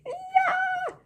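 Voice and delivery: high squealed yeah; a little squeal of joy; joyful shout. Retold or imitated. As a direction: burst out with a high happy yell. Retelling her own joyful reaction.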